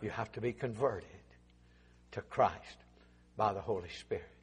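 low, hushed, slow pleading; soft altar call. Acted. Preacher pleading with the congregation in a low hushed voice. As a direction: draw them in softly, close to a whisper.

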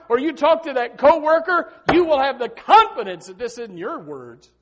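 loud, high, driving sermon delivery; full-force preaching. Real, not acted.

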